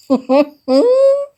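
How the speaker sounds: high, loud laughing fit; loud burst of laughter. Real, not acted. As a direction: crack up loudly at the story.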